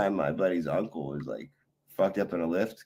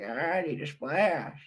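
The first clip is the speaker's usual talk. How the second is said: slow, higher, whiny put-on voice; imitating Andy; 7 semitones higher, slower than usual. Streamer mimics Andy begging at five in the morning, slow whiny voice. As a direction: drag it out like a needy overgrown baby. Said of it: "He's like".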